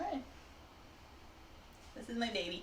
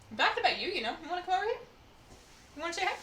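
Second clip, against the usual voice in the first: high coaxing voice for the dog; pet talk; 9 semitones higher, much faster than usual. Real, not acted. Inviting her dog over in a high coaxing voice.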